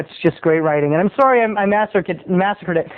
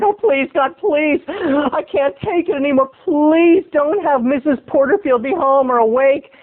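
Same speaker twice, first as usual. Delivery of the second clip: higher, drawn-out mock begging; desperate pleading; 7 semitones higher than usual. Boy begs God neighbor is asleep, desperate. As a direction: pray hard to be spared.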